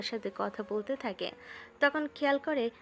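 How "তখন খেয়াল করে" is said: ordinary delivery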